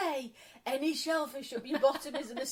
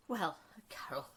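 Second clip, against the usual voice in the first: serious friend; 7 semitones lower than usual. Retold or imitated. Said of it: "this friend very seriously said".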